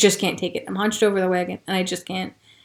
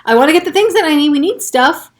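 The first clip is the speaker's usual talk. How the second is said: raised, quick, higher protest; insistent reply; 6 semitones higher, 4 dB louder than usual. Retold or imitated. Refusing her mother, insisting on staying in the store. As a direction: push back hard and fast. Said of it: "And I'm like".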